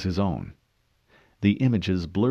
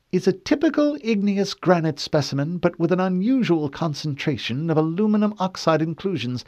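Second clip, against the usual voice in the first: high, precise questioning woman; cool female interrogator; 10 semitones higher, 4 dB louder than usual. Acted. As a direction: question him coolly.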